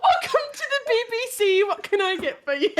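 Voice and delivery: high mock British receptionist, half laughing; giggly put-on British accent; British customer service voice. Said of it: "this is my customer service British voice".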